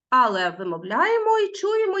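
usual speaking voice

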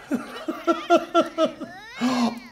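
long laugh, breathless. Real, not acted. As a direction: laugh it out helplessly. Laughing at game chaos.

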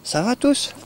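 squeaky high friendly check-in; high greeting. Real, not acted. Owner greeting her dogs in a high chirpy voice.

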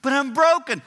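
loud, high protest; protesting cry. Retold or imitated. Believer protesting to God, loud and high. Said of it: "God goes".

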